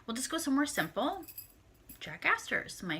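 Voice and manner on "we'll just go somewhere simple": high quoted self. Retold or imitated. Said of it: "So I'm like".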